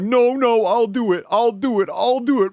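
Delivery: high, strained hysterical insistence; hammed-up, loud tearful protest; mock gagging protest. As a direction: imitate a roommate wailing through the gags. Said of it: "Like, gagging visibly and everything".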